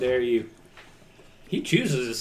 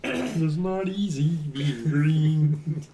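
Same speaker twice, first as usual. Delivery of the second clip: Kermit voice; much slower than usual. Acted. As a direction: do your best Kermit the Frog. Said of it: "That was pretty good".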